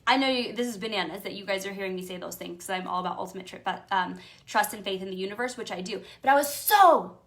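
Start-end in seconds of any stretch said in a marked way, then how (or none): 6.2-7.2 loud, high, slow, worked-up anger; loud, slow, high-pitched anger. Retold or imitated. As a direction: lean into how mad you were. Reliving her anger.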